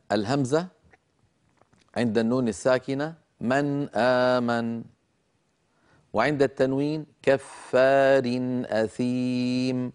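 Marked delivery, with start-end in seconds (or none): 3.4-4.8 "من آمن" with a short, clear noon, no lingering hum; clear izhar. Shown as a demonstration. Teacher showing viewers the hamza example "من آمن", clear unlengthened noon. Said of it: "عندما أنطق الأمثلة لا أزيد". 7.2-9.9 slowly recited "كفار أثيم", long vowels, short clear tanween; clear izhar. Shown as a demonstration. Teacher showing tanween before hamza, "كفار أثيم" recited slowly with unlengthened tanween. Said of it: "لا أزيد في زمن النون".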